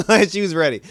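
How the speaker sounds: high, loud laugh into the word; real laugh mid-sentence. Real, not acted. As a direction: break up laughing.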